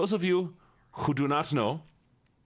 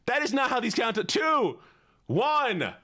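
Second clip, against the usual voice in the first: high exasperated protest; 11 semitones higher than usual. Real, not acted. Chat botches the countdown.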